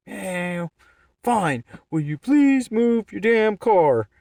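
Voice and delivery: high, loud, slow whining voice; imitating the driver pleading, high and loud; mock whiny plea. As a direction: make him sound pathetic and high. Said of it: "mocked guy asks to move".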